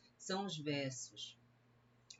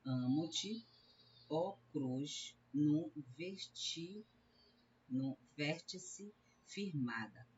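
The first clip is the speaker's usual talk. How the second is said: slow, halting line of verse with pauses; scanning verse; slower than usual. Shown as a demonstration. Teacher counting verse syllables for students, slow scansion. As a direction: sound the line out piece by piece. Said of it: "nós vamos contar".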